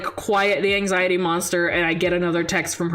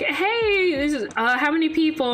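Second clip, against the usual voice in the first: chirpy waitress; 8 semitones higher than usual. Retold or imitated. Imitating the waitress greeting.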